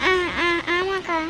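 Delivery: slow, halting yes-sister; nervous stammer. Acted. Caught sister stammering an excuse to elder sister. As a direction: stumble over the first sounds, caught out.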